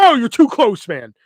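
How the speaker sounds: loud, high-pitched bodyguard warning; mock warning shout. Friend posing as bodyguard to salesman, loud mock alarm. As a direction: jump in with a playful loud objection.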